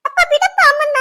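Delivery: high, urgent cries to stop; alarmed pleading. Acted. Daughter stopping father hurting mother, alarmed pleading.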